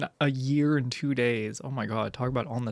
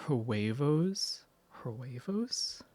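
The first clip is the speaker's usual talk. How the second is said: usual speaking voice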